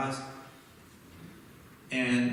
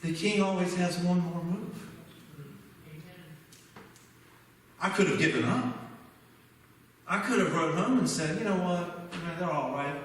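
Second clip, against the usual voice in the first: higher, emphatic pulpit delivery; preaching; 4 semitones higher than usual. Real, not acted. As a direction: drive the lesson home to the congregation. Preaches the lesson of his story.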